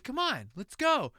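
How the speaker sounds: high, loud, slow coaxing call; urging Atreyu. Acted. Atreyu pleading with his horse in the mud, slow and coaxing. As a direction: coax the horse forward.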